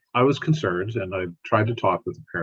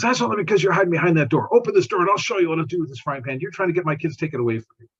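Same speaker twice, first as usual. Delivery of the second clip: high, loud, accusing yell; angry neighbor yelling; 9 semitones higher, 4 dB louder, faster than usual. Retold or imitated. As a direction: shout it at the closed door, voice pitched way up. Neighbor yelling through the door.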